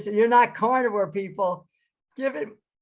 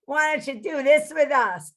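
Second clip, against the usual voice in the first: put-on cheeky kid; 8 semitones higher, 5 dB louder than usual. Kids teasing the teacher.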